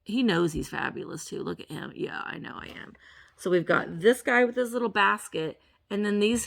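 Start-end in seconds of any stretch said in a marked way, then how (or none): 1.9-2.9 whispering figurine. Pretend. Voicing the figurine's reply.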